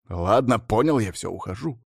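ordinary delivery, nothing marked

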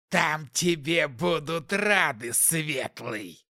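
slow taunting demon voice; slow, sly demonic taunt. Acted.

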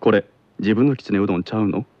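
Kansai model line for repeating; Kansai dialect. Shown as a demonstration. Teacher giving pupil a line to repeat, Kansai dialect. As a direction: say it evenly with a Kansai lilt. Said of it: "続いてください".

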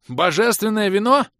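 high, loud shocked yell. A narrator's reading. Bom sees divine wine being opened, yelling. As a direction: yell it in alarm. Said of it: "заорал Бом".

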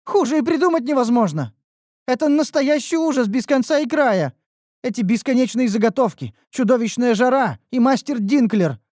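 high, loud whining complaint; young elf girl lamenting her work; whiny elf girl.

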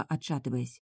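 usual speaking voice

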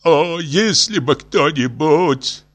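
high, very slow lament; moaning. A narrator's reading. Professor longs for a rescuer, moaning. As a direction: draw it out in a yearning groan. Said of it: "простонал профессор".

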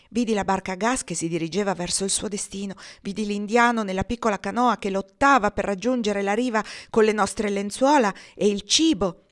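plain reading voice

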